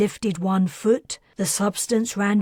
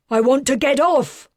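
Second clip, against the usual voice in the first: loud, higher, falling at the end; insisting on leaving; angry shout; 5 semitones higher, 6 dB louder than usual. Acted. Father demands to leave.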